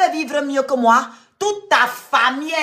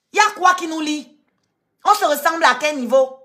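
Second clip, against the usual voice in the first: usual speaking voice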